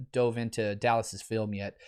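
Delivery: ordinary delivery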